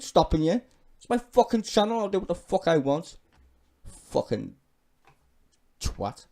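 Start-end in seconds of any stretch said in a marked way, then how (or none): none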